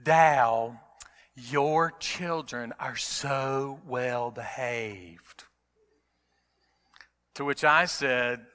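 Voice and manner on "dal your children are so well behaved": slow, drawn-out sweet Southern voice; sweet Southern accent. Retold or imitated. Friend Janet praising his children at the restaurant, sweet Southern accent. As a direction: stretch it out in a sugary Southern accent. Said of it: "in her sweet Southern accent".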